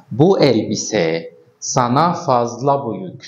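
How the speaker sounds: each Turkish word spaced out slowly for learners; slow example sentence. Shown as a demonstration. Teacher reading a Turkish example aloud to students, slowly. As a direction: read the example slowly so students can follow. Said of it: "كأن نقول مثلًا".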